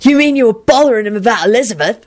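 reply with open distaste; exasperated, disgusted question. A narrator's reading. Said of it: "Sirius replied disgustedly".

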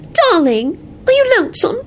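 high, cooing pity. A narrator's reading. Wife to husband on the phone, in a high pitying voice. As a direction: coo it with syrupy pity. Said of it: "responded in a pitying voice".

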